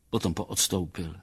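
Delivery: ordinary delivery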